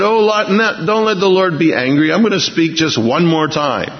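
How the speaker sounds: high, pressing plea; Abraham bargaining with God, pitched high and pleading. Acted.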